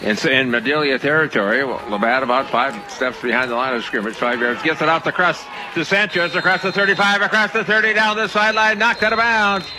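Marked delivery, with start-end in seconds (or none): 4.6-9.7 football call climbing higher as the run goes on; live play-by-play. Real, not acted. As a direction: build steadily with the runner, pitch rising.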